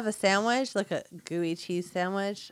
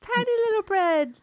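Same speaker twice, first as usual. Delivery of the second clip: high squeaky voice; 4 dB louder than usual. Pretend. Teasing co-host's bread.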